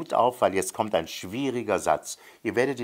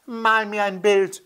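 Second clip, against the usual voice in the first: put-on high, stubborn child's demand; insistent child; 12 semitones higher, 4 dB louder than usual. A narrator's reading. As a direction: repeat it like a nagging little boy. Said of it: "der kleine blonde Junge". (hohe, quengelnde Kinderstimme)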